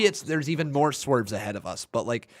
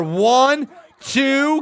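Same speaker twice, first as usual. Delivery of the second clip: excited count; 9 semitones higher, 5 dB louder than usual. Real, not acted. Calling a near-fall count live.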